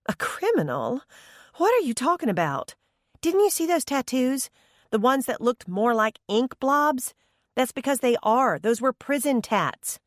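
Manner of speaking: high chiding mother voice. A narrator's reading. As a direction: gently scold like a patient mom. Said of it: "she chided".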